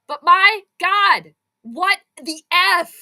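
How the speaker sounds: slow, loud, punched-out disbelief; exasperated disbelief. Real, not acted. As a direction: drag out each word, high and loud. Streamer reacts to game.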